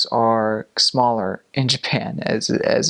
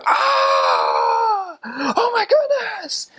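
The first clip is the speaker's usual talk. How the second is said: high, strained comic wail of pain; mock scream; much slower than usual. Man with bumped hand screaming behind them, mock agonized wail. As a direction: wail in exaggerated agony, stretched out. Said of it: "he's screaming back there".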